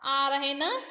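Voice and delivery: ordinary delivery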